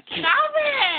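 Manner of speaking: high, loud protest; yelling. Real, not acted. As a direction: shriek at them to stop. Friend being hit.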